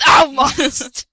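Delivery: hammed-up shout. Hammed villain line.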